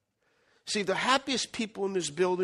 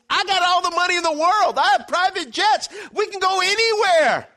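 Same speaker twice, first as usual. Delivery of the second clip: much higher, louder boast; excited rich man; 10 semitones higher, 6 dB louder than usual. Retold or imitated. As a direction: sweep him along eagerly. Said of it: "He goes".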